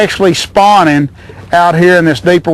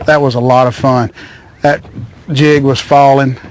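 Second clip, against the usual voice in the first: ordinary talk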